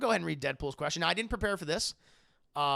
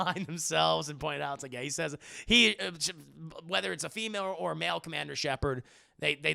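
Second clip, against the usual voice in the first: ordinary delivery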